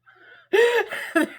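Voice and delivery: high, loud burst of laughter; loud laughing fit. Real, not acted. Tarot reader cracking up at the sad card, loud laughing fit.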